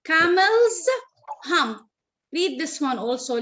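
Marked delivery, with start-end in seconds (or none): 0.0-0.9 "Camels" higher and louder, its final s voiced; stressed possessive ending. Shown as a demonstration. Teacher modelling possession for a pupil, stressed possessive ending. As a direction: lift "Camels" and sound the s at the end. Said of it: "to show possession".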